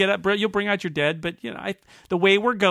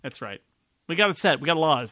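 ordinary delivery

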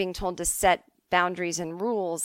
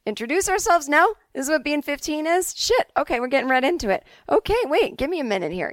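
put-on high, loud, flustered teenager; overwhelmed pupil, pitch jumping up; alarmed student protest; 7 semitones higher, 5 dB louder than usual. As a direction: halt the teacher in mock panic. Overwhelmed student halts the rushing teacher.

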